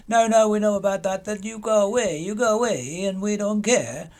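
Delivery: high mock Spanish-accent cop, brushing him off; lightly put-on Spanish accent, dismissive. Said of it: "Sorry for the, uh, accent there".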